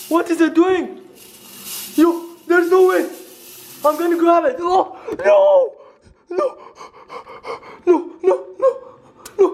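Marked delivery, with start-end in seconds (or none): 4.6-9.5 frightened cry. Real, not acted. Creature comes at him.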